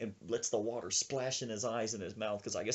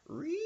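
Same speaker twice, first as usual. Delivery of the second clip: high whining motor noise; sustained high-pitched engine squeal; vocal sound effect of a blower; imitated leaf blower. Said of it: "we hear something going".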